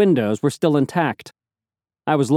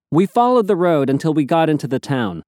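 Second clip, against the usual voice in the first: ordinary delivery, nothing marked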